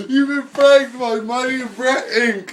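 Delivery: loud, slow, laughing announcement; prank reveal laughing. Real, not acted.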